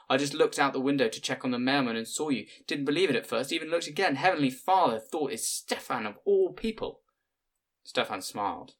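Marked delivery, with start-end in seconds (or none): 0.1-7.0 rushed, breathless chatter, pitched up; fast and chaotic. A narrator's reading. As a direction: tumble the words out in excitement. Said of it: "talking excessively fast and chaotically".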